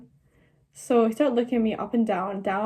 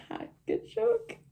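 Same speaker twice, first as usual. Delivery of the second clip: sarcastic; 11 semitones higher, 8 dB quieter, slower than usual. Retold or imitated. Sarcastic reply to his proposal.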